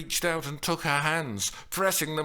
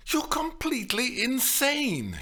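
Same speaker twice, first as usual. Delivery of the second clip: high-pitched outraged verdict; high, exasperated condemnation; indignant accusation; 13 semitones higher than usual. A narrator's reading. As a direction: tell him off, appalled. Woman berating man in quarrel.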